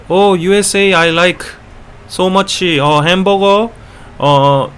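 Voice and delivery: put-on high-pitched, eager Korean-accented English. Korean fan chatting eagerly with an American, Korean accent. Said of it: "very good at impersonating Korean accents".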